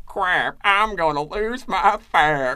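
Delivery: hammed-up Texas drawl as a flustered villain; Alan Rickman Texas accent. Podcaster imitating a British actor's Texas accent while reading the subpoena. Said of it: "doing this wonderful, wonderful Texas accent".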